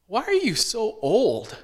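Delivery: put-on a small child's puzzled questions at grandpa; little kid asking. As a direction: ask it like a blunt five-year-old. Child blurting rude questions at grandpa.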